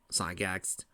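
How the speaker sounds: plain reading voice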